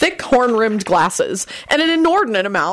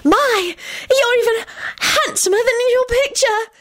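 very high, gushing flattery; high-pitched coy admiration; beaming. A narrator's reading. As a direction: gush in delight, pitched way up. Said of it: "she beamed".

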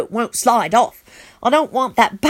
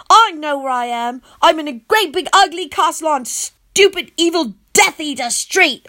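higher, defiant yell; 6 semitones higher than usual. A narrator's reading. Boy yelling back at the vampire prince, defiant. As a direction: fire back at him. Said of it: "I yell".

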